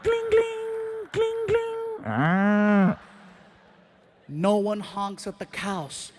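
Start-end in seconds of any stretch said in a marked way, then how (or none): 0.0-3.0 very high, ringing "gling, gling"; imitated bell ringing. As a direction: mimic a small bell in a high-pitched voice. Mimics a bell of the cow.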